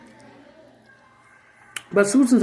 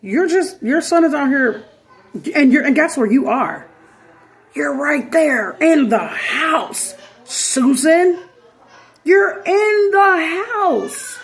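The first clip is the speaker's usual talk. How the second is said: incredulous yell; 7 semitones higher, slower than usual. Retold or imitated. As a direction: yell at her like she's missing it all. Mocking Susan for ignoring son.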